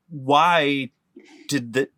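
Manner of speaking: ordinary talk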